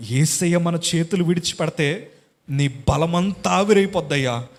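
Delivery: usual speaking voice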